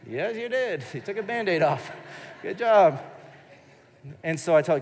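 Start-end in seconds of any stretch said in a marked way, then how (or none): 0.1-3.0 higher, sing-song parent praise; acted delighted-dad voice; praising a toddler. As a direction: cheer on a tiny child. Father praising toddler in story.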